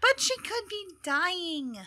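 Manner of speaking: high, drawn-out gnome protest; unhappy gnome. Acted. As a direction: plead slowly in a high gnome voice. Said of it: "a very unhappy gnomish voice".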